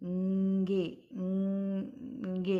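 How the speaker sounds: the ending ंगे held very slowly through the nose; held nasal ending. Shown as a demonstration. Teacher isolating the nasal ending for students. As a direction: hum out ंगे long and slow. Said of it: "इस तरह से ध्वनि आएगी".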